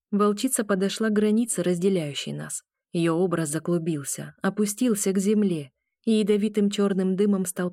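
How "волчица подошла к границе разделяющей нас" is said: plain reading voice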